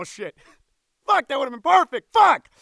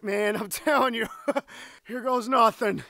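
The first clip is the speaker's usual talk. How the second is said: ordinary talk, nothing marked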